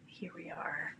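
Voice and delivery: slow, hushed guided meditation; soft spa whisper. Acted. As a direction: breathe the words gently, half-whispered.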